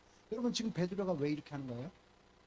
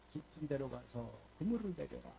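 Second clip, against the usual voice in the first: softer, lower, a gentle command; Jesus's quiet command; 7 semitones lower, 7 dB quieter than usual. Retold or imitated. Jesus telling Peter where to fish, softly. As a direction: quietly tell him to let down the nets. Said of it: "이렇게 했더니".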